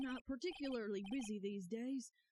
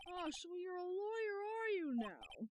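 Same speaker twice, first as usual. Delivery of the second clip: put-on higher, slow stately old man; old lawyer voice; 7 semitones higher than usual. Player voicing Grossberg questioning the lawyer, slow and stately. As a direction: let the old lawyer savour it. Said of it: "your voices have been on point tonight".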